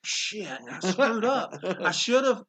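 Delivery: hammed-up panic at a mistake; mild mock dismay at himself. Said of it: "and I'm like".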